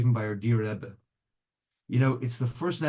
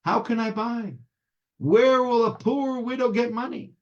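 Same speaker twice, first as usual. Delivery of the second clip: high, protesting woman's lament; indignant wail of someone with nothing; bitter complaint; 9 semitones higher, 3 dB louder than usual. A narrator's reading. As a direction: complain bitterly, rising higher as it goes. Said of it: "she complains bitterly".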